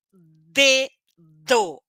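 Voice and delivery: dedo with the tongue tapping the teeth; slow teacher demo of dedo; clear dental d. Shown as a demonstration. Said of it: "minha língua tá batendo no meu dente".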